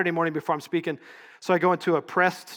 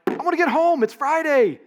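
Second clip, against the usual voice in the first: higher, louder complaint of an annoyed passenger; frustrated traveler; 12 semitones higher, 7 dB louder than usual. Retold or imitated. Stranded traveler venting at the airline agent, higher and louder. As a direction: whine that you just want to get home. Angry traveler at canceled flight.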